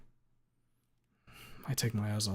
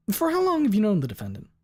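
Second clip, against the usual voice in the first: higher, louder questioning lawyer; 11 semitones higher, 8 dB louder than usual. Acted. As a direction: ask it like a confident attorney.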